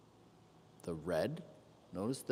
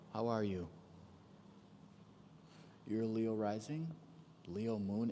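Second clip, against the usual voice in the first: ordinary delivery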